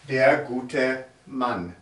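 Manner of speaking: slow, careful German for learners; slow model pronunciation. Shown as a demonstration. Teacher saying the phrase slowly for students. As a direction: sound out each word slowly for the class. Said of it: "you say der".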